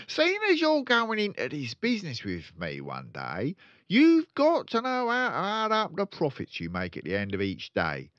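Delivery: high, loud, gloating salesman voice; boastful father. Acted. Father bragging to his son, loud and gloating. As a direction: brag about the tricks with relish.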